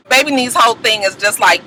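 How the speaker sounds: ordinary delivery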